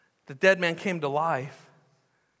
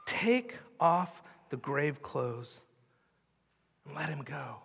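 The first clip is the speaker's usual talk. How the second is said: soft slow command; 4 semitones lower, 8 dB quieter, slower than usual. Acted. Jesus' quiet final command.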